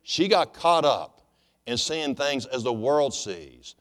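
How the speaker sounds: slowed right down, "got" hit hard and falling; slow emphatic repeat. Shown as a demonstration. Preacher repeats his point slowly to the congregation. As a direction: lean on each word of "She got caught up" the second time. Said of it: "I say this again".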